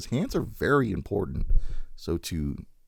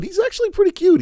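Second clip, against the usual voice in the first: gushing; 7 dB louder than usual. Real, not acted. Gushing over a cute toy.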